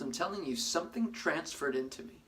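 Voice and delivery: ordinary talk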